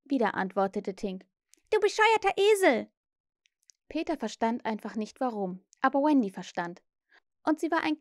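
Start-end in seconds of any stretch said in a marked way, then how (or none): 1.7-2.9 high, loud little fairy insult; cross fairy. A narrator's reading. Fairy Tink insults Peter.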